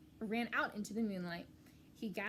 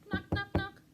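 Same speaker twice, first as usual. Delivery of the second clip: high, louder voiced knock; knocking call; 10 semitones higher, 7 dB louder than usual. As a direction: sing out the knocks. Ratty calling through wall.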